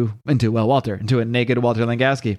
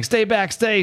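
frightened Hulk, acted; 9 semitones higher, slower than usual. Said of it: "absolutely frightened".

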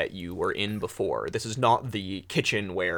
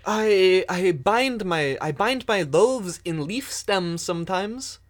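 timid baker voice; 9 semitones higher, 6 dB louder than usual. Acted. Baker Sam answers nervously.

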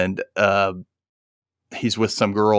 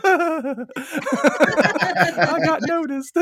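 much higher, squealing with a laugh; giddy fangirl squeal; slower than usual. Retold or imitated. Fan thanked by [name], squealing giddy and high.

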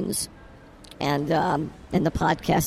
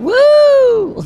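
high, loud whoop; excited whoop; 9 dB louder than usual. Real, not acted. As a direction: let out a big happy woo. Cheering while drinking.

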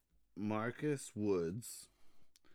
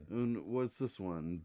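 ordinary delivery

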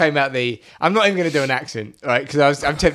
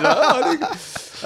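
confused listener voice; 13 semitones higher, much slower than usual. Pretend. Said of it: "they'll be like".

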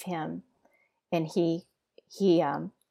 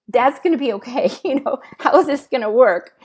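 joyful outburst; 6 semitones higher, 11 dB louder than usual. Retold or imitated. Overjoyed thought while crying.

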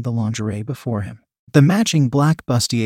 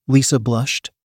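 plain reading voice

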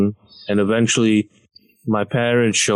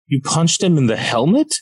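much higher, incredulous scolding; exasperated dad; 8 semitones higher than usual. Retold or imitated. Dad scolding his injured son, high incredulous rant. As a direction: scold like a disappointed martial-arts dad. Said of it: "his first response was".

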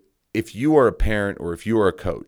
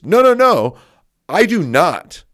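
high, loud insistent no no no; loud, high insistent denial; emphatic denial; 9 semitones higher, 8 dB louder than usual. Real, not acted. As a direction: shut down the idea firmly. Denying he yells at referees.

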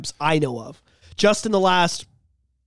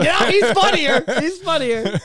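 laughing; 7 semitones higher, slower than usual. Retold or imitated. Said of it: "I'm like".

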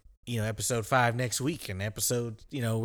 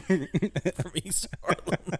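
laughing slow echo; 7 semitones higher, much slower than usual. Retold or imitated. Mocking echo of friend's line.

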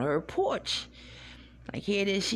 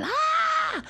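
high, loud shriek of fright, imitating mom; mom's scared yell; 5 dB louder than usual. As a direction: scream it out like she's terrified of the cat. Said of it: "she's yelling".

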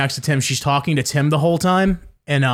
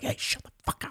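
fierce whisper telling someone off; whispered shush; 15 dB quieter than usual. Retold or imitated. Mocking the shushing crowd, in a harsh whisper. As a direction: hiss it under your breath.

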